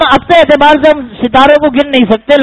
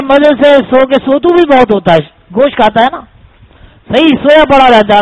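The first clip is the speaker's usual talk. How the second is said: usual speaking voice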